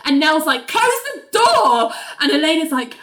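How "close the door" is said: high, drawn-out mock yell; mock angry command. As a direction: order it in hammed-up outrage, slowly. Nell yells from toilet stall.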